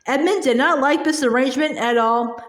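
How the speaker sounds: ordinary delivery, nothing marked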